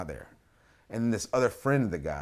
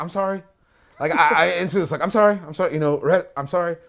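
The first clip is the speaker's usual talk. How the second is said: flustered apology; 5 semitones higher, 8 dB louder, faster than usual. Retold or imitated. Apologizing after throwing guy.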